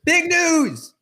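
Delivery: high-pitched excited exclamation; excited announcement. Real, not acted. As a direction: belt out a big headline. Host announces big headline.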